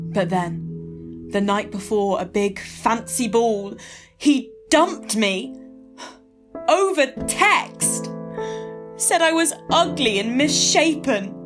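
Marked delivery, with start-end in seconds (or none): 4.2-11.3 heartbroken. Acted. Heartbroken recollection, slow.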